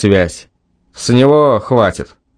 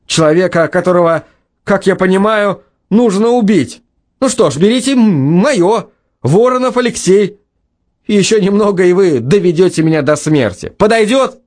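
higher, sarcastic fury; furious; 7 semitones higher than usual. Acted. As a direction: spit it out in rage. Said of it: "Лёшка был в ярости".

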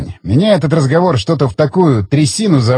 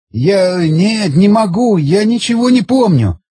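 pleading; 6 semitones higher than usual. Acted. Beaten man pleads.

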